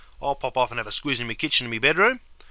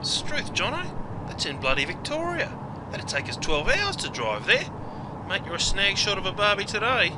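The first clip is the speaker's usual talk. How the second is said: high, hammed-up Aussie exasperation; Struth, scold your mate like he's daft; exasperated mate; 7 semitones higher than usual. Mate scolding Jono over the drive, high mock exasperation.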